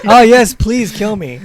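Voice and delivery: high, loud laughing; laughing sarcastic plea. Real, not acted.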